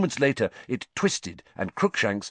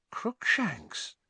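soft, hesitant whisper; uncertain whisper; 9 semitones higher, 6 dB quieter than usual. A narrator's reading. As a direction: breathe the name, unsure. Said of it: "Hermione whispered uncertainly".

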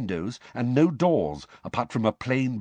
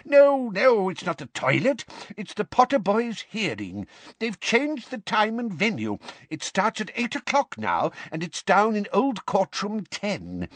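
put-on high, fretful old clerk voice; a flustered timid old wizard babbling fast; anxious old wizard; 11 semitones higher than usual. A narrator's reading. Perkins pours out his worry to Arthur in a high fret.

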